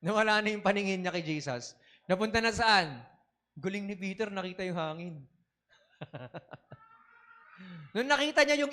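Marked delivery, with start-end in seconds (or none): none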